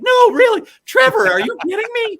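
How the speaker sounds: high, loud, excited squeal; very high and loud impression of an excited analyst; Tim Kurkjian excited. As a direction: squeal it like a thrilled baseball analyst. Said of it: "When he really gets excited".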